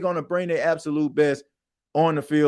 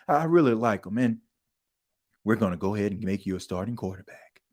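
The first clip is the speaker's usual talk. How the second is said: low, soft coach with the big news; 7 semitones lower, 5 dB quieter, faster than usual. Pretend. Coach telling the player he starts, low and quick. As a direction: lower and softer, then faster.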